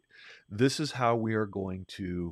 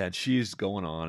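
ordinary talk

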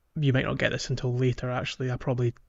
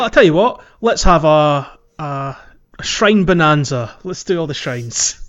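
excited; 10 dB louder than usual. Real, not acted. Host hyping up a shrine bonanza to co-host, excited. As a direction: pump up the crowd for a bonanza.

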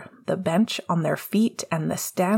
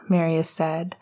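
usual speaking voice

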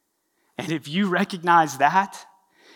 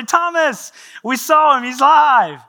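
excited news; 10 semitones higher, 12 dB louder, slower than usual. Retold or imitated. Best buds run to tell Thomas.

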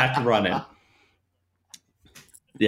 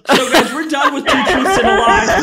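much higher and louder, laughing through the words; 8 dB louder than usual. Real, not acted. Trainer calling a break while laughing. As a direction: give up on the game laughing.

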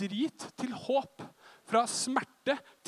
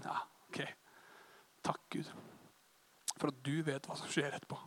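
whispered prayer; 7 semitones lower, 12 dB quieter than usual. Retold or imitated. Quiet thanks to God in prayer.

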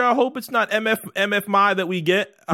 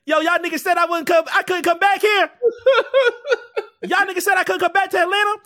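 defiant boast; 13 semitones higher than usual. Retold or imitated. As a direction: brag to the crowd, pitched way up. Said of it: "And he comes to the birthday bash".

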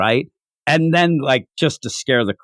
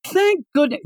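high, sighing thank goodness; mock relief pitched way up; relieved girl; 14 semitones higher than usual. Classmate at bedside, high hammed-up relief.

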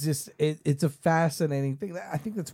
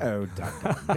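imitating the alien; 3 semitones lower than usual. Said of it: "He's like".

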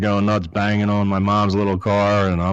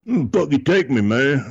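grumbling; 6 semitones higher than usual. Retold or imitated. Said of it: "he's just like".

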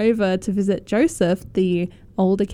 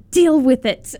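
sassy dismissal; 3 semitones higher, 8 dB louder than usual. Real, not acted. Mocking a book's critics.

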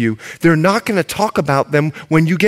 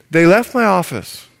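ordinary talk, nothing marked

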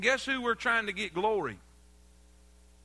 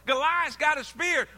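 louder, higher shout on Goliath's; shouted build; 5 semitones higher, 3 dB louder than usual. Acted. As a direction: punch it harder the second time. Preacher building David story.

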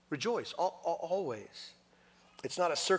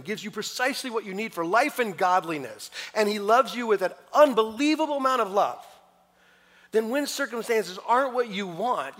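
much higher and louder impassioned sermon peak; preaching at full force; 8 semitones higher, 12 dB louder than usual. Real, not acted. Preacher urging the congregation to trust God, fervent and loud. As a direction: build to a fervent pulpit cry.

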